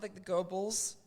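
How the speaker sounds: ordinary talk, nothing marked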